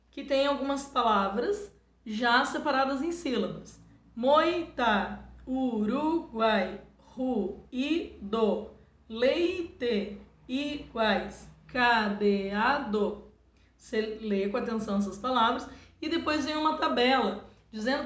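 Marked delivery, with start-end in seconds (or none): 4.2-11.5 Uruguai read very slowly, every vowel distinct; slow dictation voice; slow clear reading. Shown as a demonstration. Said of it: "quadrinho no exercício dois". 11.7-13.2 cadeado drawn out, ca-de-a-do; teacher stretching cadeado; slow syllable reading. Shown as a demonstration. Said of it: "quadrinho no exercício dois".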